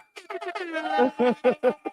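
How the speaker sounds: long high laugh; helpless high-pitched amusement; real laughter. Real, not acted. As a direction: burst out laughing at a joke. Amused by the great value trees joke.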